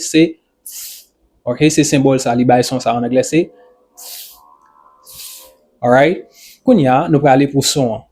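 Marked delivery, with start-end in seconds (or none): none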